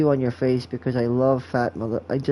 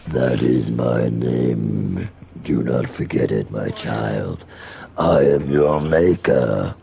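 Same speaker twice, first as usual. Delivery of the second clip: very deep, slow, commanding demon; much slower than usual. Acted. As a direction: intone it like a demonic maker. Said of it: "I could, like, do the demon voice".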